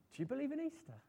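high-pitched, bright-eyed asking; Edith's eager question. Acted. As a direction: ask it eagerly, pitched well up. Edith's eager question to hospital listeners.

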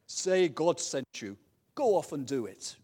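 loud, slow God voice; booming voice from sky. Acted. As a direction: boom out the command. God answers Moses.

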